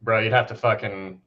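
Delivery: ordinary delivery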